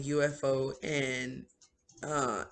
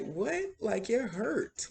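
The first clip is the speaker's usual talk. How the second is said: shocked disbelief; 8 semitones higher, slower than usual. Retold or imitated. Retelling own shocked reaction.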